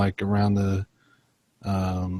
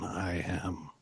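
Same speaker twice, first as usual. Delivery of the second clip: softer whispered thought; 4 semitones lower, 14 dB quieter than usual. Acted. Sam's thoughts trailing off in front of classmates, whispered. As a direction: let it fade to a breath. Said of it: "inside Sam's thoughts".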